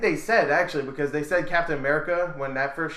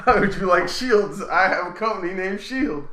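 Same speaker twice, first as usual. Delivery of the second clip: higher, louder mock Nick Fury pitch, slowish; recruiting Captain America in a hammed-up tough voice; Samuel Jackson impression; 5 semitones higher, 6 dB louder than usual. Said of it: "That's my Samuel Jackson impression".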